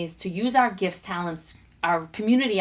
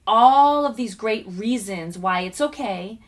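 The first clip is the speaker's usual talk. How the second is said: ordinary talk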